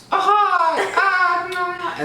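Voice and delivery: very high, loud imitated child's shriek; mock pained scream. Child reacting to dad's hot sauce, mock shrieking. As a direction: squeal as if the mouth is burning.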